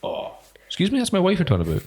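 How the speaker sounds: high, prim mock indignation; mock offended. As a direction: pretend to be scandalised.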